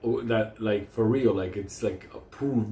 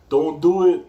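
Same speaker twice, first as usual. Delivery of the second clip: loud, higher shouted warning; 5 semitones higher, 10 dB louder, slower than usual. Retold or imitated. Shouting at people waiting for shots, a loud warning. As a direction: call it out loud to the line. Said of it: "I'm like that".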